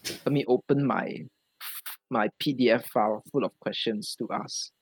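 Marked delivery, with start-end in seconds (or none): none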